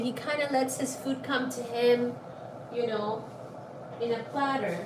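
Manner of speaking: soft talk. Real, not acted. Much softer talk.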